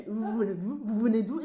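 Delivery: "Vous venez d'où" blurred into one loud string; mock-baffling run of linked French in "Vous venez d'où"; words run together. Shown as a demonstration. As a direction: repeat "Vous venez d'où" with no word breaks, exaggerated. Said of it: "你找不到断句".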